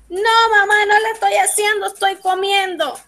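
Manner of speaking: put-on high, quick kid's protest; child answering mother. Fulanito tells mamá he is eating, high-pitched protest. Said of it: "Y fulanito va a decir".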